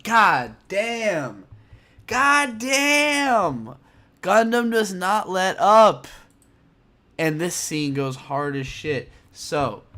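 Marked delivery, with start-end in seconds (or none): 0.0-6.1 loud, higher mock outburst of disbelief; exclaiming. Reacting to the anime scene, a loud higher shout. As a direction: shout it out, rising with each God damn. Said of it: "And I'm just like".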